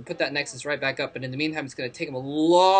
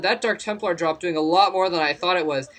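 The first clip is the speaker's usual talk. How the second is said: ordinary talk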